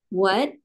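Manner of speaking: what rising like a question; second tone. Shown as a demonstration. Tone mnemonic for students, second tone. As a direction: lift the pitch through what. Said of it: "nada dua".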